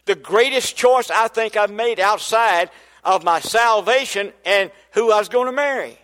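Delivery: ordinary delivery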